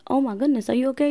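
high, alarmed oh my goodness; startled concern at a tearful husband; concerned surprise. Retold or imitated. Wife startled to see husband tearing up, concerned.

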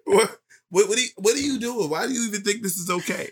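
high, fast, outraged questions; outraged disbelief. Acted. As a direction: rattle it off, appalled and high. Acted outraged captive.

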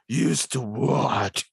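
slow, lightly voiced Batman; a hint of a brooding Batman. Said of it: "the part of Batman be played by Jason Batman".

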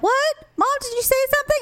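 put-on very high-pitched child's query; Olivia. Mother imitating her daughter overhearing her talk, high-pitched. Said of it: "Olivia will be like".

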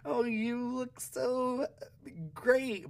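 very high, drawn-out mock compliment; high-pitched, slow, awkwardly fake praise; forced polite compliment. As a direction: stretch out strained praise way up high. Retold awkward compliment to girls.

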